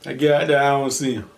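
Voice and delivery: usual speaking voice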